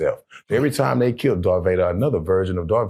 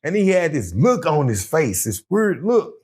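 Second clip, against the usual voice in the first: excited greeting; 9 semitones higher than usual. Retold or imitated. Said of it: "I'm like".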